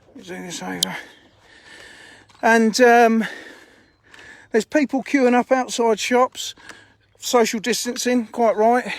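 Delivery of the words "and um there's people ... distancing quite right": usual speaking voice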